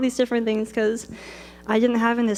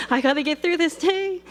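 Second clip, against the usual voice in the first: tearful plea; 7 semitones higher than usual. Retold or imitated. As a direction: voice pitched higher, close to tears. Breaking down on phone with dad.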